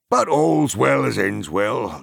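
slow, loud words through a laugh; chuckling. A narrator's reading. Farmer saying goodbye to the hobbits, chuckling slowly. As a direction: laugh off the day's fright.

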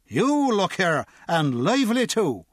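put-on sharp drunk comeback; indignant drunk. A narrator's reading. Drunk snaps back at the guard, indignant and tipsy. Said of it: "the drunk said".

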